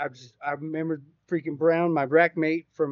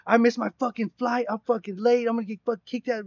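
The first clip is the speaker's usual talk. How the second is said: raised, louder furious rant; angry cussing out; 6 semitones higher, 5 dB louder than usual. Retold or imitated. Rack mate yelling down the phone about his missed flight, furious and loud. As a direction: chew him out for missing the flight. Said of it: "cussing me out".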